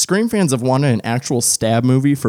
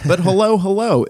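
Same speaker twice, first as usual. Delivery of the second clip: excited greeting; 8 semitones higher than usual. Real, not acted. Greeting the audience.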